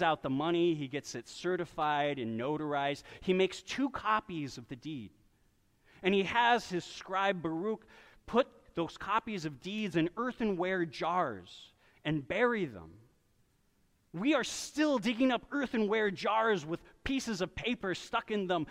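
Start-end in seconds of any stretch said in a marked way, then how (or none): none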